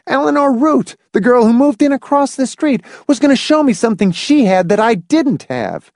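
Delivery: put-on high-pitched boyish indignation; vigorous boy. A narrator's reading. Boy telling of the garage trick, high and vigorous. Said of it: "David continued vigorously".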